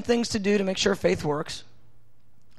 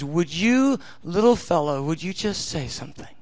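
lower, much softer tender coaxing; voicing God; 4 semitones lower, 5 dB quieter than usual. God coaxing a guilty believer to pray, softly. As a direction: gently coax the little fellow. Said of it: "God goes".

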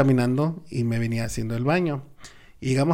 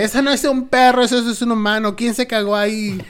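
mother scolding; 8 semitones higher than usual. Retold or imitated. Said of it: "ya va mi mamá y me dice".

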